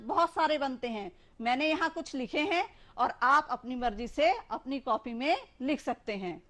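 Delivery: ordinary delivery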